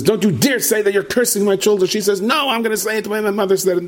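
high-pitched heated argument; quarrelling couple. Retold or imitated. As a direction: argue back hotly, pitch way up. Acted quarrel of husband and wife.

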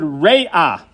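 "Re'ah" drawn out slowly as reh-AH, the ah glide sounding before the final guttural; careful Hebrew pronunciation. Shown as a demonstration. Teacher slowly spelling out "Re'ah" for the congregation as reh-AH. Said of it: "pronounced as if it was Aleph Ayin".

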